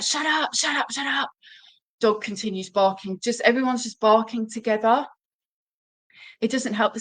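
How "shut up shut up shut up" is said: high, snappy shut-up at the dog; mock yell. Frustrated owner snapping at the barking dog, high. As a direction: bark it back at the dog, pitched way up. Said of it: "we're like".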